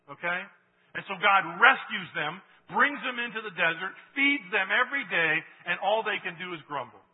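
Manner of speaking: preaching at full force. Acted. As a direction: drive the point home to the church.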